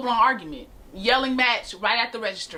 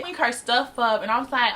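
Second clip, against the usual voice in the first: ordinary talk, nothing marked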